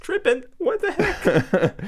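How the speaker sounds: high mock-shocked teacher voice; mock outrage. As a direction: squeal it in disbelief. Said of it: "I'm like".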